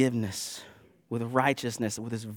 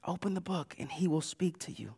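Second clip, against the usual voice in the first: very soft, intimate repeat; quiet, earnest exhortation; hushed urging; 11 dB quieter than usual. Real, not acted. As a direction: drop the volume right down to let it land.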